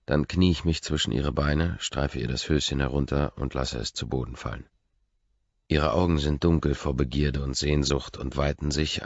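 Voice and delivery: ordinary delivery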